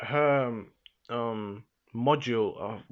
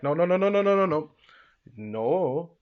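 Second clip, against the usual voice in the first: rapid run of loud, higher no's, then one alone; mock refusal; 5 semitones higher, 5 dB louder than usual. Axido stopping those trying to leave, emphatic refusal. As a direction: cut them off with a string of no's. Imitates villain refusing the escape.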